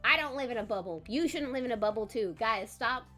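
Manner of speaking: ordinary delivery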